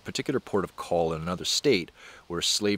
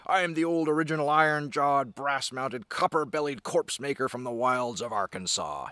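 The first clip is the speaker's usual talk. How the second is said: higher-pitched, theatrical frontier brag; boasting brawler; 6 semitones higher than usual. Acted. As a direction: declaim it like a brawler showing off. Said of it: "He's boasting, right?".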